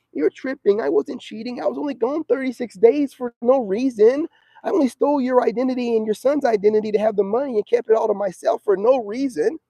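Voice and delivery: high mocking voice of the ex; sarcastic high imitation of the cheating ex. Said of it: "she gonna try to mentally manipulate me".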